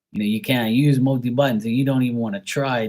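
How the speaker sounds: ordinary delivery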